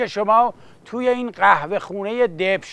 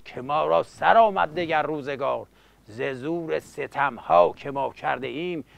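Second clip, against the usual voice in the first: softer rhythmic verse recital; epic teahouse storyteller; 3 semitones lower, 4 dB quieter than usual. Acted. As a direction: recite the bard's tale quietly.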